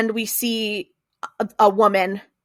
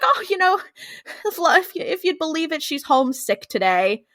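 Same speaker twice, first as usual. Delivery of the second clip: lightly voiced old neighbor, pitched up; a small chuckle in a higher, folksy imitation; neighborly small talk; 8 semitones higher than usual. Voicing grandfather's small-talk reply.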